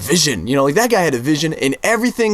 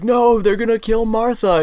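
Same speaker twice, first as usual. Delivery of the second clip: Superman; 9 semitones higher than usual. Retold or imitated. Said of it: "Superman's like".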